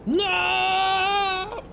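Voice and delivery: long high yelled "no"; hammed-up death cry; falling scream. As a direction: scream as you fall. Villain falls to his death.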